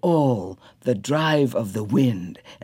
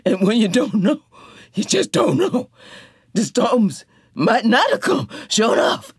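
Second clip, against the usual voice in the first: Janey; 8 semitones higher than usual. A narrator's reading. Janey arguing.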